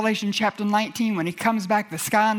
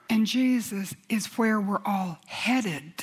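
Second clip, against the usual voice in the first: ordinary delivery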